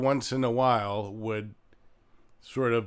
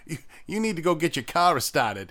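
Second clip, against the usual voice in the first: higher, louder Boston accent; 6 semitones higher, 5 dB louder than usual. Shown as a demonstration. Playing along with the Boston bit, Boston accent. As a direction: drop the R's like a Bostonian. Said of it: "if you're in Boston".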